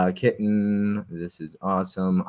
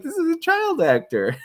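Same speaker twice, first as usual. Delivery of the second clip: laughing impression. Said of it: "I was like".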